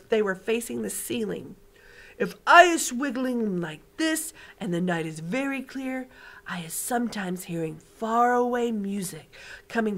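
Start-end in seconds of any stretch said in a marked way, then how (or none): 2.2-10.0 big friendly giant showing off his hearing; booming giant. Acted. BFG voice again.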